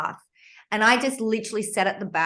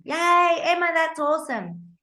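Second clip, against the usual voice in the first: excited cheer; 8 semitones higher than usual. Retold or imitated. Class cheering her essay.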